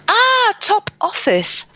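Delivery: high, drawn-out English "Top Office" of sudden recognition; English accent. Retold or imitated. Teacher finally recognising the shop name, English-accented exclamation. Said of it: "Parce que ce sont des mots anglais".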